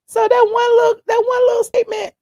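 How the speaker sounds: indignant high yell; outraged shout. Real, not acted. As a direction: cry out the words in outrage. Outraged at the backlash.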